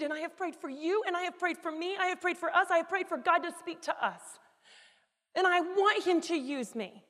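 high, strained pleading as if crying; acted tearful plea. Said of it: "I just start to cry".

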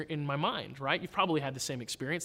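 ordinary delivery

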